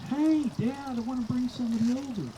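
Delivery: high chirpy daughter on phone. Retold or imitated. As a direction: chirp it like his grown daughter.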